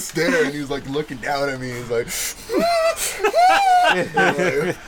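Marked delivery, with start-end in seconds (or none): 2.1-3.9 very high mock wail; imitated kid shriek. Little kid looking down at him, high mock wail.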